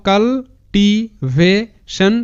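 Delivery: very slow, broken into separate syllables. Shown as a demonstration. Teacher breaks the word down for students, syllable by syllable. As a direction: sound out cultivation piece by piece, pausing between. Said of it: "এরকম করে উচ্চারণটা করবে".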